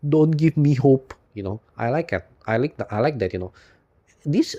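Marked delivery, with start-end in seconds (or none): 0.1-1.1 slightly higher, louder and slow movie line; lightly acted Hawkeye quote; quoting a film hero. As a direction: deliver the famous line slowly and emphatically. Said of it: "the famous quote".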